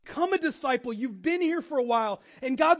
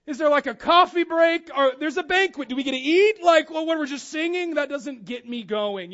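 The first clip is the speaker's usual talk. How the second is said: mock restless complaint; 4 semitones higher, 8 dB louder than usual. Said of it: "And I'm like".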